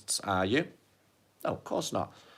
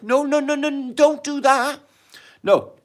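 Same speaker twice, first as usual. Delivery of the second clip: high pleading protest, much higher and louder; 6 dB louder than usual. Real, not acted. Pleading with Stacey not to cry, high protest. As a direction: rush to stop a friend from crying.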